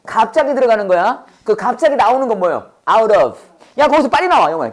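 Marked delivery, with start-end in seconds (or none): 3.8-4.5 mildly urgent, higher-pitched order; urgent call. Acted. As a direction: hurry someone out, half acted. Acts out urging someone out. (다급한 척 재촉하는 말투)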